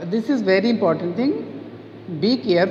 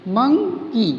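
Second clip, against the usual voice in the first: "Monkey" in two beats, मं, की, pitched up; syllable by syllable; 4 semitones higher, much slower than usual. Shown as a demonstration. Teacher counting two syllables in monkey, split apart. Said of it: "टू syllable".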